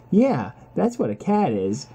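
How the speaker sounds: normal talking voice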